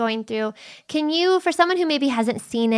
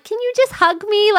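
pleading; 11 semitones higher than usual. Retold or imitated. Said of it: "sometimes I'm like".

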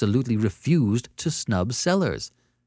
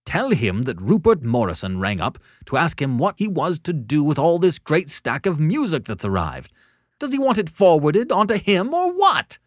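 fretful caller climbing louder and higher; growing high and excited; 7 semitones higher, 6 dB louder than usual. A narrator's reading. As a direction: build from agitated to a high, loud demand. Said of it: "The voice was growing high and excited".

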